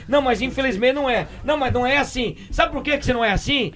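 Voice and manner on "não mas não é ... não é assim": normal talking voice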